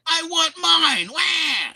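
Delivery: put-on high-pitched, drawn-out baby wail; whiny baby. Mocking sitcom adults as little babies, whiny wail. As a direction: whine it like a bawling infant. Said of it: "They're all a bunch of little babies".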